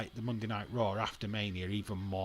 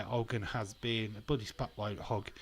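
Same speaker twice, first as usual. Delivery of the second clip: ordinary talk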